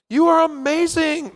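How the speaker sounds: high, sugarcoated compliment; gushing praise. Acted. Padding criticism in a confidence sandwich, gushing. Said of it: "give me the little confidence sandwich".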